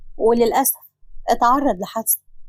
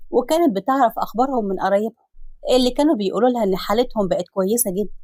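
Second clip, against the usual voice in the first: ordinary delivery, nothing marked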